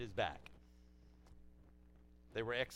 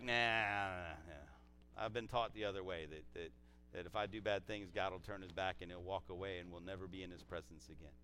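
doubtful listener; 7 semitones lower, 7 dB quieter than usual. Acted.

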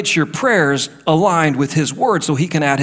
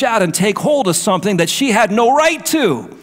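preaching at full force; 6 semitones higher than usual. Acted. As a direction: rise into a fervent climax. Sermon builds to full force.

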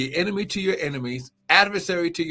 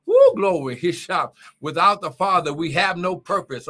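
rising, louder preacher's call; fiery preaching; 2 semitones higher, 5 dB louder than usual. Acted. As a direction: shout the praise, build the fire. Preaching at full force.

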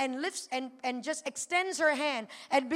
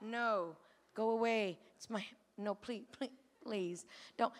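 low, very soft plea; hushed pleading; 5 semitones lower, 11 dB quieter than usual. Retold or imitated. Son inwardly begging the approaching woman to stop, hushed pleading. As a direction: beg under your breath.

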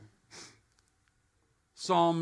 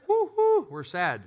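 high mock cheer that drops flat; sarcastic woo-hoo; 9 semitones higher, 4 dB louder than usual. Preacher mocking joy that ends with the bills. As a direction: cheer then sink into gloom. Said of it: "settle up with your debt".

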